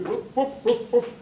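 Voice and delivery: high, soft mock woofs; playful imitation of a dog barking an answer; dog barks. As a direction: count out the number like a show dog barking.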